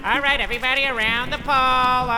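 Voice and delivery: high, taunting crowing with loud "ha ha"; acted mocking laughter. Harvey mocking agents circling the pole, acted laughing taunt. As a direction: jeer at your followers with a put-on laugh. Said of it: "Harvey continued to laugh at them".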